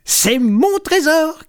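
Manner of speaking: high, gleeful kitten voice; triumphant, high-pitched crowing; excited cry. A narrator's reading. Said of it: "crie Jean Chat en se frottant les pattes".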